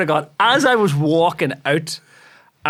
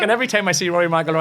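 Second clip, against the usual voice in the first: ordinary talk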